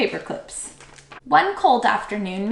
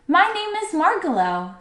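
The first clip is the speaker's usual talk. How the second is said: high, lilting little bird; soft musical voice; 8 semitones higher than usual. A narrator's reading. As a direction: introduce yourself in a light singsong. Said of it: "said the bird softly and in a musical voice".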